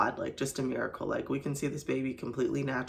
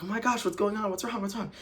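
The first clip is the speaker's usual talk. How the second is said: higher-pitched, alarmed imitation of husband; mock-panicked questions, pitched up but not shouted; freaking out husband; 7 semitones higher, faster than usual. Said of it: "he's, like, freaking out".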